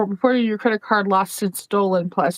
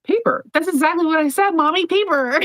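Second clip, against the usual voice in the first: mock child voice; 6 semitones higher than usual. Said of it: "And I'm like".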